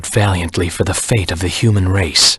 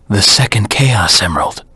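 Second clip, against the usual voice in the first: normal talking voice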